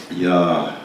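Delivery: slow, low meditative reading with long pauses; slow psalm reading. Real, not acted. Leader reads a psalm for listeners to experience, slowly. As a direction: read it slowly and low, phrase by phrase. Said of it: "I'm gonna read these things slowly".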